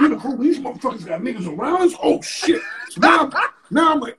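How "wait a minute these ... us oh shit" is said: rushed, alarmed muttering that breaks into a breathless "oh, shit"; panicked realization. Retold or imitated. Retelling to the host how he realized the promoter had men planted round them: rushed, alarmed. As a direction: blurt it fast like the trap just snapped shut.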